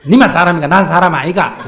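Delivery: loud, high, indignant protest; loud indignant protest to the son; strong indignant retort. Shown as a demonstration. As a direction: demand to be counted as a person too. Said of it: "그렇게 딱 세게 나오면".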